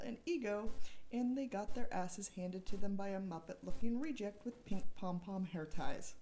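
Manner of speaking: ordinary talk, nothing marked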